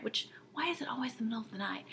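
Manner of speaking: quick whispered grumble; whispered aside. Real, not acted. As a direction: mutter it under your breath, fast. Tired aside about night wakings.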